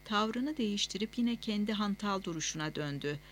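ordinary delivery, nothing marked

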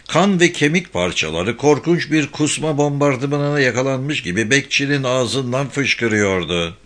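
ordinary delivery, nothing marked

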